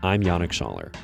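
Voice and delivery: ordinary delivery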